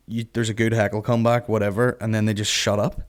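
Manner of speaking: normal talking voice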